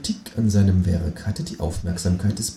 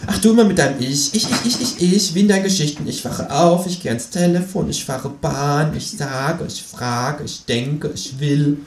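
mocking kangaroo; 4 semitones higher than usual. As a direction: tease him, fast then fading. Kangaroo mocks narrator's ich-stories.

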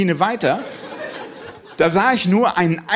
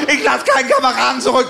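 much higher, louder heroic war-movie cry; war-mode soldier; 7 semitones higher, 3 dB louder than usual. Retold or imitated. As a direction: bark it like a soldier rescuing a comrade.

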